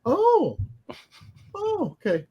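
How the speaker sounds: high, cracked little "oh" of shock; startled reaction. Retold or imitated. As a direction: catch your breath, caught off guard. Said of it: "she goes".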